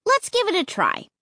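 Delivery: high, coaxing urging. A narrator's reading. Child persuades parents to try it, urging. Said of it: "I urged them".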